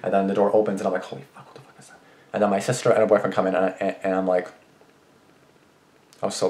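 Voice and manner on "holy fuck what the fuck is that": very soft, voiceless mock alarm; retold frightened whisper. Acting out the door opening, a startled whisper. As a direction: whisper it as if afraid to be heard.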